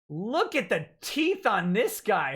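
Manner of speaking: ordinary delivery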